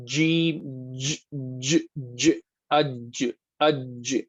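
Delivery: separate, deliberate "ج" sounds for a student to copy; jeem articulation. Shown as a demonstration. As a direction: isolate "ج" as a crisp j sound, then "اج" with a vowel before it. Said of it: "جیم کہاں سے آتا ہے؟".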